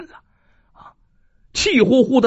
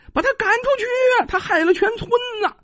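angry villager shout; 13 semitones higher, faster than usual. Acted.

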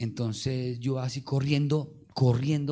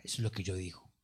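whisper; 8 semitones lower, 10 dB quieter, faster than usual. Real, not acted. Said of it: "aside to congregation".

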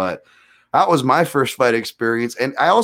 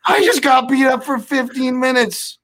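much higher, incredulous whine; exasperated; 14 semitones higher, 4 dB louder than usual. Retold or imitated. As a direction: whine in disbelief. Exasperated retort to girlfriend.

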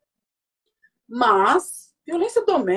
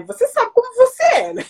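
snide judging quote; 14 semitones higher than usual. Retold or imitated. Quoting a friend's judgment.

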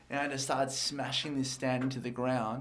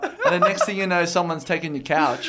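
laughing while telling; laughing at his own joke; 4 semitones higher, 8 dB louder than usual. Real, not acted. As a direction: laugh through the words.

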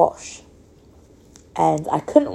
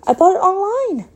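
much higher, quick mock protest; retold indignant protest; faster than usual. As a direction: squeal it defensively, retelling a squabble. Retold squabble with husband over clothes.

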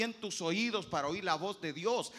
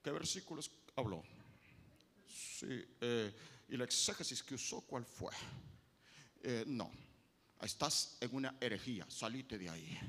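lower, softer preachy know-it-all; a lightly voiced judgmental believer scolding you; mock holier-than-thou; 10 semitones lower, 8 dB quieter than usual. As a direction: act like a sanctimonious church critic. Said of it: "salen los espirituales".

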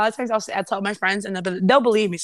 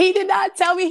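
disbelief; 10 semitones higher than usual. Real, not acted. Shocked retelling of ex's secret.

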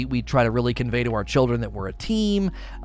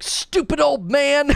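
much higher, slow mock shout; kid yelling insult; 11 semitones higher, slower than usual. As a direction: imitate an angry four-year-old yelling. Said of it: "He's like".

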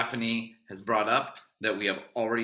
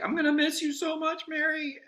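much higher, overdone tearful goodbye; mock dramatic farewell. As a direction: ham up a melodramatic goodbye. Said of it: "genuinely, I wasn't doing it like that".

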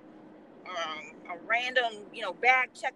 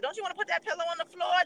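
quoting the man; 8 semitones higher, faster than usual. Retold or imitated. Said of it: "He was like".

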